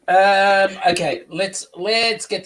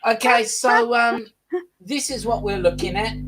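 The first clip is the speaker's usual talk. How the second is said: usual speaking voice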